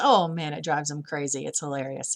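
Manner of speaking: usual speaking voice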